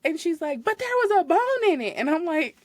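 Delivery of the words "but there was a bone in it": whiny customer. Retold or imitated. Customer voiced whining.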